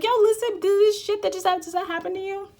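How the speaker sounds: high, eager burst; excited call to a friend. Pretend. Calling a friend to share a psychic moment, excited. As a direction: grab a friend on the phone, buzzing.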